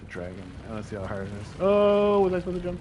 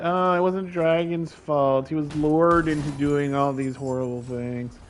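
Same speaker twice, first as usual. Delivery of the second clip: mocking whiny voice; 4 semitones higher, 8 dB louder, slower than usual. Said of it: "They're gonna be like".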